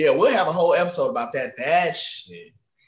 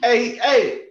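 higher and louder shout to the back; calling out; 8 semitones higher, 5 dB louder than usual. Retold or imitated. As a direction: call to the stockroom.

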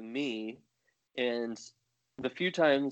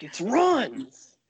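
exasperated correction; 12 semitones higher, 7 dB louder than usual. Retold or imitated.

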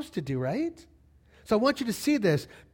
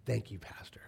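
whispered thanks; 10 semitones lower, 13 dB quieter than usual. Retold or imitated. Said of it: "and he goes".

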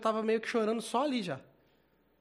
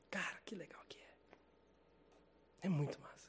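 slow hushed whisper of wonder; slow awed whisper, barely voiced; 13 dB quieter, much slower than usual. Real, not acted. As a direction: breathe it out slowly, almost silent.

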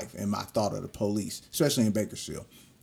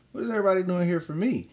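high, fast, alarmed; stunned disbelief; 9 semitones higher, 3 dB louder, faster than usual. Retold or imitated. Facing officials in the dean's office, high fast alarmed disbelief. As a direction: caught off guard by the crowd.

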